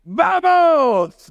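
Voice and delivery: alto, fuerte y muy agudo, como un juez de cocina que anima; juez animando. Retold or imitated. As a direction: grita el vamos como un jurado que empuja al cocinero. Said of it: "de los jueces decía". ("¡Vamos!" de juez de cocina, agudo y enérgico)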